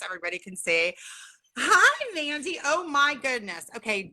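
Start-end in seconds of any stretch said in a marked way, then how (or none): none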